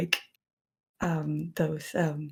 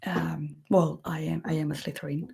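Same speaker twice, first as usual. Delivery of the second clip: usual speaking voice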